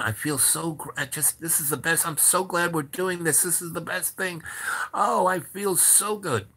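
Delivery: acted high, gushing happiness; gushing delight. Madame tells him she loves their project, gushing.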